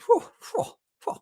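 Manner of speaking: high-pitched breathy relief puffs; shaky relieved exhales; high relieved whews. Real, not acted. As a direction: blow out the fright in little gusts. Relief after the fright.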